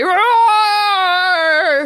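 loud mock roar; imitated roar. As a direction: roar like a beast. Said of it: "it's like".

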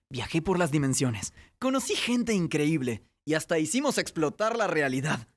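ordinary talk

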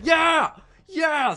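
loud, high-pitched cheer; triumphant shout. Real, not acted. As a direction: yell it out in triumph. Triumph after chant to hat.

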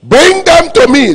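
high, loud, slow divine command; commanding God voice. Acted. Pastor voicing God summoning enemies, high loud command. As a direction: boom it out as God calling for the enemies.

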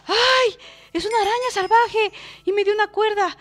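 high, loud excited exclaiming; emoción a gritos. Real, not acted. Excited at spider dropping string. (exclamar agudo y emocionado)

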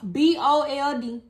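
B O L D spelled letter by letter, slowly; spelling it out. Shown as a demonstration. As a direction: spell B O L D one letter at a time. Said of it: "What you mean the bowl?".

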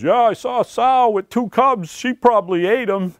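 high-pitched quoted neighbor voice; neighbor's blurting. Acted. Neighbor butting in on a worried family, blurting. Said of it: "the neighbor comes over and says".

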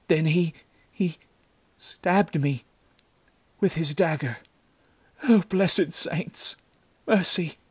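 high, faltering, anguished plea; dying girl. A narrator's reading. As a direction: gasp out the horror with failing strength. Dying girl tells of stabbing.

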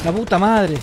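loud frustrated curse; panicking gamer. Real, not acted. Gets shot, swears.

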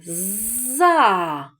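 "за" with both sounds drawn out slowly; stretched syllable. Shown as a demonstration. Teacher sounds out the word, "за" stretched slowly. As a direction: hold the buzz, then a long А in "за". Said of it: "Який перший звук чуємо?".

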